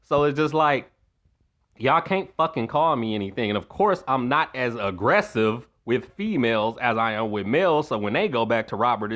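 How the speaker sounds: usual speaking voice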